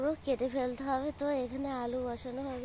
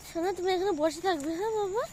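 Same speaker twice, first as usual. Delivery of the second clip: higher, louder coaxing voice; 7 semitones higher, 4 dB louder than usual. Real, not acted. Mother tells her child to sit here while she works.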